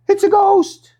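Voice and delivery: high, panicked mock cry; frightened cry. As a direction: squeal it like a terrified fisherman. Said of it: "Their first reaction was".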